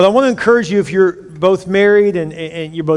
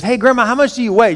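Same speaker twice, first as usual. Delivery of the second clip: granddaughter; 6 semitones higher than usual. Retold or imitated. Granddaughter voiced in joke.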